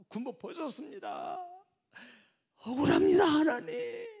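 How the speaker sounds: high, loud wailing complaint to God; wailing prayer. Acted. General wailing to God over his lost stars: anguished wail. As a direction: cry out the injustice to God. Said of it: "통곡의 눈물 흘리면서".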